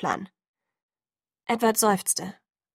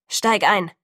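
loud, curt angry order; angry command; 5 dB louder than usual. A narrator's reading. Rescuer orders narrator into the car, angry. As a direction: order them in angrily, voice raised. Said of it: "kommandierte eine zornige Stimme".

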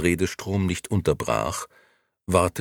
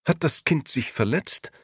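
more reproach than concern; a lady's mildly reproachful question; 9 semitones higher than usual. Acted. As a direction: ask it like a cool rebuke. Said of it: "eher vorwurfsvoll als besorgt".

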